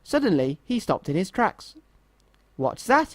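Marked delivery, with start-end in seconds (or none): 2.6-3.1 gasped. A narrator's reading. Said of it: "he gasped".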